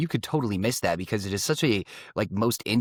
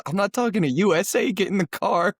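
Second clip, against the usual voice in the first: higher, louder mock bark at the kid; gruff dad order; 8 semitones higher, 3 dB louder than usual. Dad ordering his daughter into the car, mock exasperated. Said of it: "He says".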